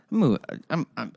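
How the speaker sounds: ordinary delivery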